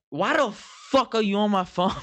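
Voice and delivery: high-pitched indignant demand; retold anger. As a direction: reenact drunken outrage at someone snooping. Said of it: "I was angry".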